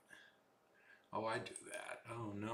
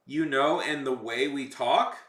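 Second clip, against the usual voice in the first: higher, louder TikTok accent with an upturn; 5 semitones higher, 7 dB louder, slower than usual. Shown as a demonstration. Streamer showing viewers how TikTok people talk, TikTok accent. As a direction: put on a TikTok accent, slowing into a rise. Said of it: "people on TikTok have a TikTok accent".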